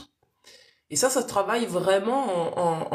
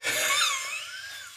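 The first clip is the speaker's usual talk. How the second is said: high squeal of laughter; 4 dB quieter than usual. Real, not acted. Laughs alone on live.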